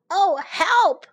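lost in the woods, pretend panic; mock cry for help. Old king lost in the woods, calling out in mock fright. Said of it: "cried the king".

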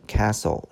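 "castle" said with no T sound; "castle" with S straight into L; silent T. Shown as a demonstration. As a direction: leave the T out of "castle", as "cassle". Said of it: "注意T不发音".

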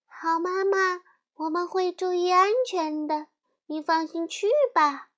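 高声尖细的小羊, 乖巧答应; 用高高的童声乖乖回答妈妈; 小羊们回答. A narrator's reading. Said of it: "小羊们回答". (小羊们高声细气地回答妈妈)

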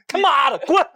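sudden high cry of fake rage; hammed-up angry yelp; mock tantrum squeal; mock furious outburst. Mock rant at friend's post.

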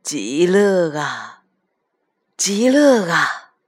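put-on very slow, savoured old man's words; blissful grandpa. A narrator's reading. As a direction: stretch each word out in contentment. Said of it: "一边又重复了一遍".

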